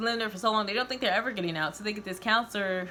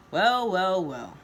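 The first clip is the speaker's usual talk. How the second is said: smug; 5 dB louder, much slower than usual. A narrator's reading. Villain greets them.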